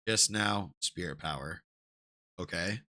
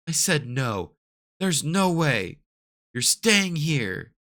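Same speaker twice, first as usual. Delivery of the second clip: slow firm refusal; 5 semitones higher, slower than usual. Acted. Mother refuses firmly.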